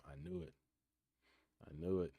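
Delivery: ordinary talk, nothing marked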